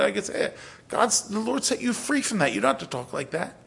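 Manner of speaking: ordinary talk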